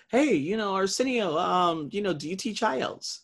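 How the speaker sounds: a hint of a girl's eager question; student asking. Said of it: "she was like".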